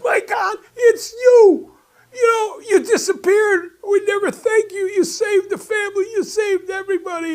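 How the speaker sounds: high, loud, tearful shout of joy; overjoyed shout. Retold or imitated. Tony calls out to the stranger, tearful and overjoyed. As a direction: cry out in grateful disbelief.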